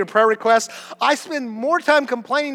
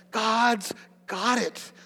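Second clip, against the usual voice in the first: calm them down, unhurried; quiet slow reassurance; reassuring; 7 dB quieter, much slower than usual. Real, not acted. Pastor reassuring congregation.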